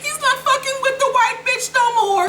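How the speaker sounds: very high squealing falsetto glee; mock glee. Tasha in bed thinks husband's back, mock gleeful squeal. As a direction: squeal it like a mocked, overjoyed wife. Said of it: "all filled with glee".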